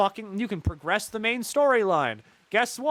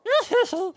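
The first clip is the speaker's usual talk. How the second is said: high silly giggle; high-pitched goofy laugh; 4 dB louder than usual. Retold or imitated. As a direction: cackle like a giddy little goblin. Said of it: "Festi's like".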